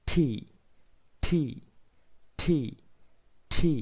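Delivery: ঠি said with an aspirated "t" and a long "ee"; ঠি repeated, a puff of air after the "t"; ঠি stressed like English "tee", with a breathy "t"; correct letter T. Shown as a demonstration. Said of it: "টি এর সঠিক উচ্চারণ হচ্ছে".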